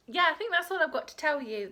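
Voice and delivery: ordinary talk, nothing marked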